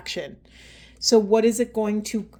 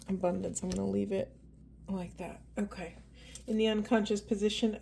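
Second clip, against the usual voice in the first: hushed, unhurried questions to Spirit; soft intuitive reading; 9 dB quieter than usual. Acted. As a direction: murmur it like a quiet reading. Quiet tarot card pull.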